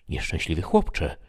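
plain reading voice

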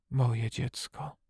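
slow, soft, moved whisper; hushed, tender old man. A narrator's reading. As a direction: breathe it out slowly in awe. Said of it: "wyszeptał".

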